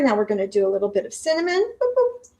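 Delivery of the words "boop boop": high squeaky boop boop, higher pitched; playful sound effect. Pretend. Sprinkling cinnamon for viewers, a playful high boop. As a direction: sprinkle the cinnamon with a silly mouth noise. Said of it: "Maybe the sound effects again".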